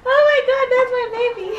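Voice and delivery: high squeal between laughing and tears; overcome with emotion. Real, not acted. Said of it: "I'm actually going to cry".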